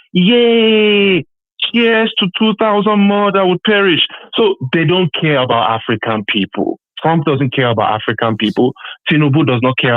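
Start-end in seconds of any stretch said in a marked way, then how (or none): 0.1-4.1 high, drawn-out mock cheer; mock celebrating. Caller imitating a woman cheering migrant deaths, mock celebrating. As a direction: imitate her gloating hurrah, slow and high. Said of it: "she was celebrating. She was like".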